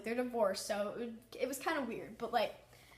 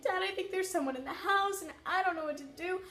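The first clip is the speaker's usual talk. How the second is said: high, tearful, changed voice, pleading with dad; retold sobbing; 7 semitones higher than usual. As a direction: replay the frightened sobbing call to dad. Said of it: "I'm, like, sobbing on the phone".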